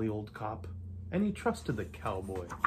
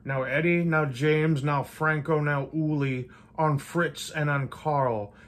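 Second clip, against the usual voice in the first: louder roll call of names, slowing at the end; shouting orders; 2 semitones higher, 5 dB louder than usual. Acted. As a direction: bark each name like a commander on the radio. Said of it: "radioed and shouted and called them by name".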